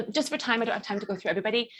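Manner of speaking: ordinary delivery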